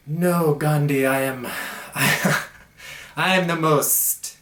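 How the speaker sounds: mock boastful Gandhi. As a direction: puff up like a smug guru claiming the most peace. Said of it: "I'm throwing some Jim Carrey lines at you".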